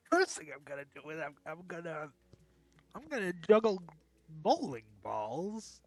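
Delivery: high, loud goofy puppet bear; hammy comedian bear, yelling the jokes; Fozzie Bear voice. Acted. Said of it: "my Fozzie Bear impression".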